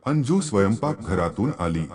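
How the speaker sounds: plain reading voice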